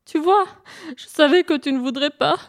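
high, hammed-up whimper; lamenting. Hare laments that the sun will refuse, wailing. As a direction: wail reproachfully, mock tearful. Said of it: "se lamenta le lièvre".

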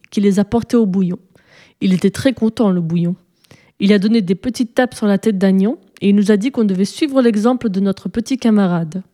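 plain reading voice

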